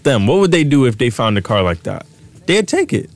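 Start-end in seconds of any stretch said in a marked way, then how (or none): 2.5-3.1 squeaky high-pitched voice. Shown as a demonstration. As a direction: jump way up in pitch. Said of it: "That was me going high pitch".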